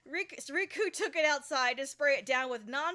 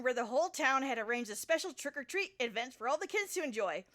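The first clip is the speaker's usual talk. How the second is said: plain reading voice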